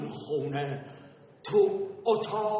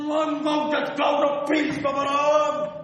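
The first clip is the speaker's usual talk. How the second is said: raised, high-pitched insistence; insistent outburst; 6 semitones higher, 3 dB louder than usual. Acted. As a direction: push it out high and adamant. Told to calm down, insists.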